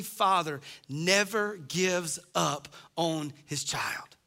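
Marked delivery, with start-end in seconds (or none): 2.4-4.2 whisper. Real, not acted. Tender point in sermon.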